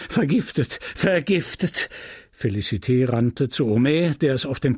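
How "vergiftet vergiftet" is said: higher-pitched, horrified repetition; stunned repeating. A narrator's reading. Husband can say nothing else, stunned repeating. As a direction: repeat the one word in horror. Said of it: "nur das eine Wort wiederholen".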